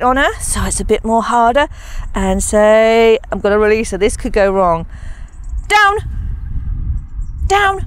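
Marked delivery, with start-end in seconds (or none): 5.7-7.9 sharp command. Real, not acted. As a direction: bark the cue at the dog. Commanding dog to drop.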